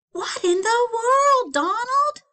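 high, loud and slow outrage; shocked wife. Acted. Wife shouting at Donald over the mess, shocked and high. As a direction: cry out in shock.

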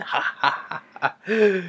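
mock laugh rising in pitch; forced nervous laugh. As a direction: fake a desperate ha-ha. Forced joking laugh within acted panic.

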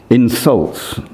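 normal talking voice

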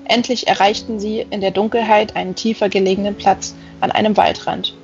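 ordinary delivery

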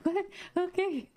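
high-pitched, confused retold reply; mock bewilderment. Flustered driver answering her yelling friends, mock bewilderment.

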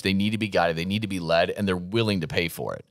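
normal talking voice

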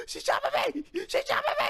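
high-pitched squealing cry; shrill, playful panic; panicked. Real, not acted. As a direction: squeal it while under attack, half laughing. Being attacked in game.

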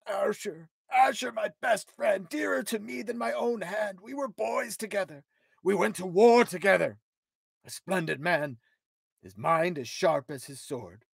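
loud, high anguished grief over a murdered friend; grieving lament. Acted. King mourning his murdered friend, anguished and loud. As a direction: cry out the loss of a best friend.

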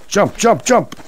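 excited shouting to jump; urgent jump calls. Real, not acted. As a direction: call it out, building up.

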